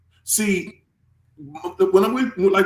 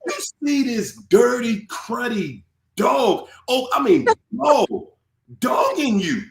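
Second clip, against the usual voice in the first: higher, shouting scold in bursts; imagined scolding; 7 semitones higher than usual. Retold or imitated. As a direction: yell at him like a fed-up friend. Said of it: "like, Get your ass over here".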